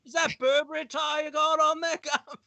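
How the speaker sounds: high-pitched mock Cockney. Prince asking about his brother's Burberry tie, high Cockney. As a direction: play a chirpy Cockney royal. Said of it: "I'll do the com-, that, that conversation".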